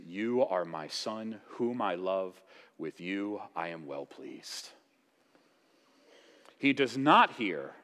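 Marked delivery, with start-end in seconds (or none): 0.1-4.7 tender voice of God. Acted. God's voice at the baptism.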